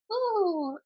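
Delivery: high airy hummingbird coo; light, high bird-like ooh; hummingbird impression. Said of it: "That was my impression of a hummingbird".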